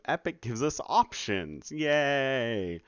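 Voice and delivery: fake-cheerful yay; sarcastic cheer. Dev mocking Epic's design, sarcastic cheer.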